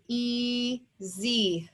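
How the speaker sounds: "E, Z" as two held, separate syllables; slowly divided "E, Z"; split into syllables. Shown as a demonstration. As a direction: break easy into a long "E", then "Z". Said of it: "That's where my syllable division line would go".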